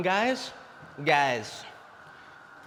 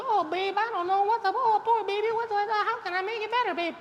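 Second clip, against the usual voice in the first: mock simp voice. Mocking himself soothing his pouting girlfriend, high simpering coo. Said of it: "go into simp mode".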